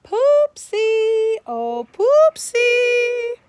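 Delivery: high, loud, drawn-out call. A narrator's reading. Girl calls through the woods for a missing turtle, long high loud calls. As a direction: call the lost pet across the forest.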